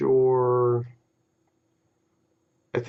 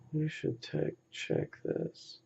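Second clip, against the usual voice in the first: whispered aside; 3 semitones higher, 16 dB quieter than usual. Real, not acted. Aside while thinking.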